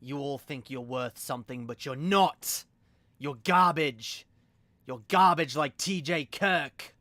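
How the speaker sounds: edgy British villain rant, building loud; Lord Darkay voice. Shown as a demonstration. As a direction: punch each insult harder, in British. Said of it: "the Lord Darkay voice".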